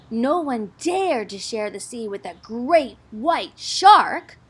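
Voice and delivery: ordinary delivery, nothing marked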